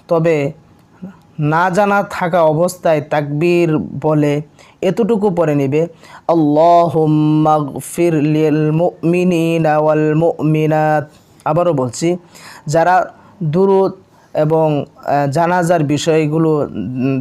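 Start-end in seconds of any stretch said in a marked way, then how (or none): 6.4-11.0 very slow teaching recitation; drawn-out, level devotional chant; Arabic prayer recitation. Shown as a demonstration. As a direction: stretch every syllable so learners can follow. Models short prayer for learners.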